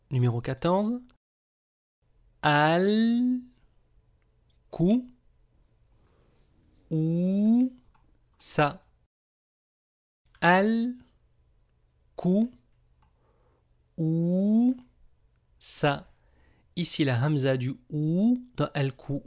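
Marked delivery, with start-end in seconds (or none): 2.4-8.8 each syllable spelled out slowly, high start. Shown as a demonstration. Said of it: "syllabe par syllabe". 10.4-16.1 each syllable spelled out slowly, high start. Shown as a demonstration. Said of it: "syllabe par syllabe".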